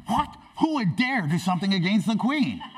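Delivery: high-pitched, feigned indignation echoing the king; mock shock. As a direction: parrot the outrage as a shocked villain. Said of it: "Haman is shocked".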